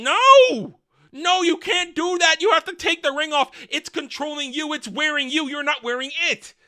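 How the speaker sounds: high-pitched imitation of a pleading son; mock pleading. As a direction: beg frantically, hammed up, opening on a loud cry. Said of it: "Durin is pleading with his father".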